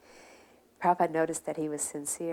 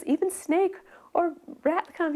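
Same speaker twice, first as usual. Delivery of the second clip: put-on high, louder old man's voice; voicing the old man; 12 semitones higher, 4 dB louder, slower than usual. Old Vrindavan resident telling his visitors, high and proud. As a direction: lift into a much higher, eager old man.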